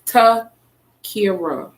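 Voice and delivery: Takira very slowly, syllable by syllable; name sounded out. Shown as a demonstration. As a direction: stretch Takira into separate parts, "Ta... kira". Spelling out her name for listeners.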